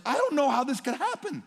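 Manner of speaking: high, loud cry of confusion; grieving outcry. Retold or imitated. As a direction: cry out in grief, lost. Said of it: "in my grief where I'm saying".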